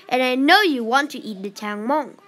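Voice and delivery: ordinary delivery, nothing marked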